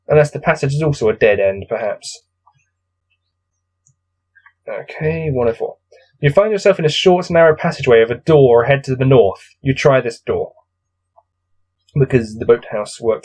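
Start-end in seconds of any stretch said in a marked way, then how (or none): none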